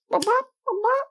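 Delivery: very high little pecking noises; imitated pecking bops. Storyteller imitating a pigeon pecking at something, high bops. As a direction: squeak out a bird's pecks. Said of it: "and it's like".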